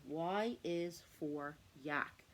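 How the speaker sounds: slow, spelled-out teaching phrase; slow and clear. Shown as a demonstration. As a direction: say it slowly for a child to copy. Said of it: "slow modelled phrase for child".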